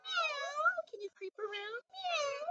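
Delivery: high, soft kitty meows; kitten meow. Pretend. Teacher playing a cat for the kids, high meows. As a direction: mew like a little kitten. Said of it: "creep around like a cat".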